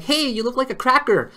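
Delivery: mock jab.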